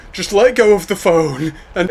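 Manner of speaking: high, loud urging; urgent. Acted. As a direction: press him to let go. Urging boy in storm.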